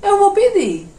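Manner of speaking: high, exaggerated Pernambuco twang; Pernambuco accent. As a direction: put on a broad Pernambuco accent in disbelief. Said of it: "o Arias aqui da cidade de Pernambuco". (sotaque pernambucano exagerado, agudo)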